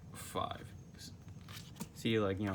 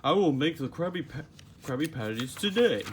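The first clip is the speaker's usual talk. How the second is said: Patrick voice; 5 semitones higher, 6 dB louder than usual. Acted. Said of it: "said Patrick".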